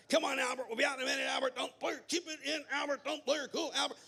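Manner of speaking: high-pitched, rattled, rapid-fire pleading; hammed-up panic, words tumbling over each other; frantic dad. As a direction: play a father at the end of his rope. Dad careening through the aisles.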